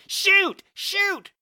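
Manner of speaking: two very high, loud yells; frantic repeated command; crying out. A narrator's reading. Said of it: "Shotgun cried out".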